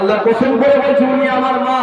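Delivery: put-on high, chanted oath of a child; insistent child. Child swearing to Ali she is his mother, high insistence.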